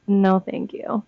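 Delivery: ordinary talk, nothing marked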